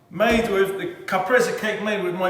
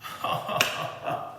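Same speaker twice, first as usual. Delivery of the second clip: soft, breathy giggle; a soft laugh of pure pleasure; quiet laugh of delight; 9 dB quieter than usual. Real, not acted. Overcome by the taste.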